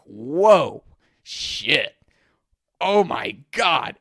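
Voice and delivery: loud, drawn-out awe; overwhelmed amazement. Retold or imitated. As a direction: act out being blown away. Reliving overwhelming pleasure.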